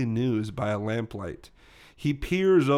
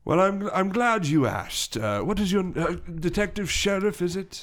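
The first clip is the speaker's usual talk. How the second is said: slow, higher English character voice; English gentleman gorilla; 6 semitones higher than usual. Acted. Gorilla addressing the detective, slow higher English voice. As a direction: begin ponderously, groping for the name.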